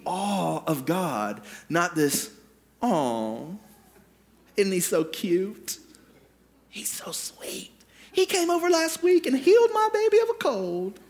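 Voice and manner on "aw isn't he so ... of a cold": cutesy gushing, dropping to a whisper then squeaking high; mock cooing. Churchgoer gushing over a sweet little God, mock cooing. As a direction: coo as if over a baby, hammed-up.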